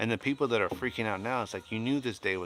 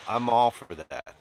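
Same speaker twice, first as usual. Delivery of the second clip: slow and loud, leaning on "all"; emphatic; 3 semitones higher, 9 dB louder than usual. Real, not acted. Telling viewers he supports assistance, loud emphasis. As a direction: drive home "all" with force. Stressing his support for help.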